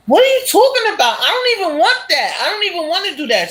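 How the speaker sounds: husband protesting his wife's plan, high and appalled; outraged disbelief. Retold or imitated. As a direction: refuse it in shocked outrage.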